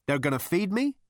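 high, rising hopeful question. A narrator's reading. Zaphod guesses at his Frog Star fate, hopeful. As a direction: voice lifts eagerly to the end.

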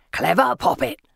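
taunting villain calling out; Asriel's yell. A narrator's reading. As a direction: call out mockingly in a put-on voice. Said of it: "Asriel yells".

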